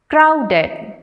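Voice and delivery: "crowded" with CROW high, falling after; stress on first syllable. Shown as a demonstration. Teacher reading words to students, "crowded" stressed on CROW. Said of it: "stress on the first syllable".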